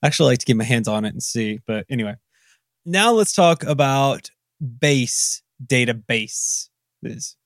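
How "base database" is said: "base" drawn out and separated, also inside "database"; stress on base. Real, not acted. Host slips while introducing the topic, stressing "base" slowly. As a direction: lean hard on "base", slow and set apart. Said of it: "I emphasized the base in that word".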